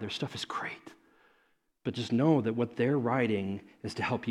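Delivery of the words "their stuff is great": whisper. Real, not acted. Aside in sermon.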